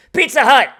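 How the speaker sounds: loud, sudden cry of recognition; excited shout. Real, not acted. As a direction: blurt it out, thrilled. Excited at seeing the ad.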